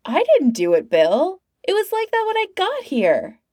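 high-pitched, protesting excuse; defensive hapless hero. A narrator's reading. Narrator voices Ralph's excuse.

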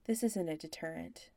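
ordinary delivery

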